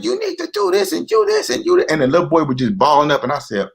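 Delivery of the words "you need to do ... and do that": very high-pitched mock yell; imitated yelling. Imitating a neighborhood man to his son, retold yelling. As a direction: imitate a father hollering at his child. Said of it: "yelling at my son".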